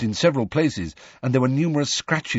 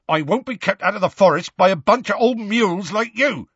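high, raised-voice indignant outburst; loud defiance; 11 semitones higher, 4 dB louder than usual. A narrator's reading. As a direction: refuse loudly and defiantly. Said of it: "said Hagrid loudly".

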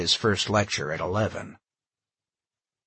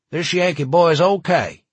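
put-on loud, higher good-ol'-boy voice; 8 semitones higher, 7 dB louder than usual. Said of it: "the redneck broke out laughing".

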